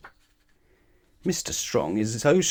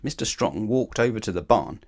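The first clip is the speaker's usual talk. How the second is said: ordinary delivery, nothing marked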